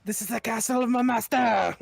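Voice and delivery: loud, high put-on character voice; theatrical shout for laughs; hammed-up proclamation. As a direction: declare it grandly at full volume. Hammed-up declaration in character, then laughter.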